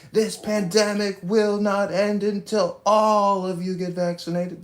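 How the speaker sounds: high, slow mocking politician voice; hammed-up impression of a preachy vaccinologist; Darren LaHood. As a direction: drag out a high-pitched mock proclamation. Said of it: "a vaccinologist who said".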